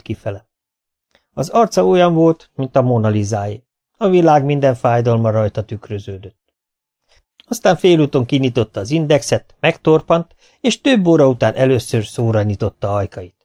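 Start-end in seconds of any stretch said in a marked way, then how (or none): none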